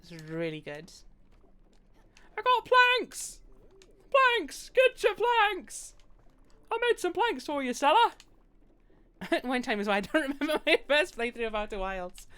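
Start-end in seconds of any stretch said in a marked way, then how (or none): 2.4-8.1 high, loud market hawker voice; loud vendor call. Acted. Game vendor shouts to the crowd hawking planks. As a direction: call out your wares like a peddler.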